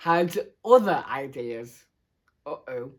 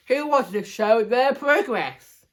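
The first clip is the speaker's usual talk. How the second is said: teacher addressing class; 6 semitones higher, 3 dB louder than usual. A narrator's reading.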